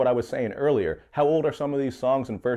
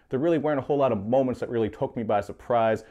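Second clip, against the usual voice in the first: normal talking voice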